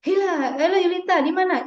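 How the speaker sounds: high, bright "halo Yulita"; lively caller greeting. Shown as a demonstration. Lecturer phoning a student, high and lively. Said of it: "dosennya nanya".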